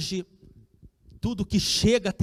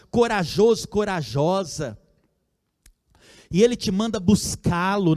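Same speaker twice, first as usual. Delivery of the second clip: fervent preaching; 4 semitones higher than usual. Acted. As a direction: build the congregation's courage. Preaching at full force. (pregação fervorosa e alta)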